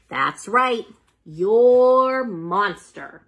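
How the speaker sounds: plain reading voice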